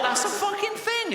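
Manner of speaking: very high, appalled squeal; incredulous shock. Retold or imitated. Seven-year-old hears of the willy-cutter, incredulous. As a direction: squeal it in disbelief. Child reacts to mum's horror story.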